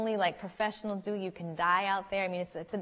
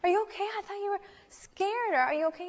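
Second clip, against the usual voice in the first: high, loud imitation of a frantic mother; acted motherly alarm, pitched way up; alarmed mom; 13 semitones higher, 3 dB louder than usual. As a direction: check on your child in a high, urgent voice. Alarmed mom questions her daughter.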